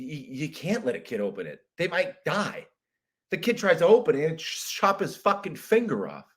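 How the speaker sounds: ordinary talk, nothing marked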